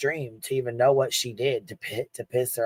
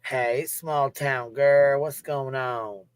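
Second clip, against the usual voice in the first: drawn-out greeting; much slower than usual. Real, not acted. Drawn-out sing-song greeting.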